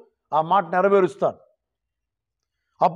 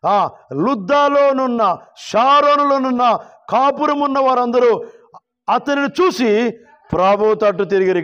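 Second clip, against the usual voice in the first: high, sustained sermon proclamation; forceful preaching; 7 semitones higher, 3 dB louder than usual. Real, not acted. Preacher proclaims Peter's healing to the congregation, high forceful preaching. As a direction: ring out each phrase, drawing out the scripture.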